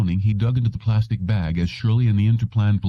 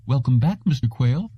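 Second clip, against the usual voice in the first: high, bright welcome from a flustered receptionist; fluttering receptionist; 7 semitones higher than usual. A narrator's reading. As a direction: flutter the greeting, eager to please. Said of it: "she fluttered".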